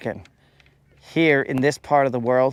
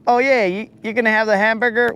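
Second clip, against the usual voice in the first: higher, quick mock waiter pitch; 8 semitones higher, faster than usual. Streamer as waiter pushing a menu item, hammed-up. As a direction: play an overeager waiter. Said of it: "I'm gonna be all like".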